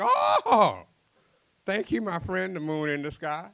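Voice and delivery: shrieked high and loud thanks; high excited cry. Acted. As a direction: squeal thanks up at the moon. Night fisherman thanks the moon.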